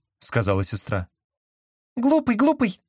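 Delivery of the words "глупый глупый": very high squeaky parrot echo; squeaky toy. A narrator's reading. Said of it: "пропищал он".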